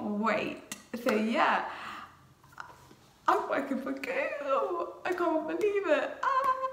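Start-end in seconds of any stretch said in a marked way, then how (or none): 3.3-6.1 high, drawn-out squeal of delight; real excitement. Real, not acted. Vlogger announcing her new Google job to viewers, thrilled squeal. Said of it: "I'm just so excited". 6.2-6.7 very high squeal of joy; excited squeal. Real, not acted. Vlogger who can't believe she got the job, high happy squeal. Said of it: "I'm just so excited".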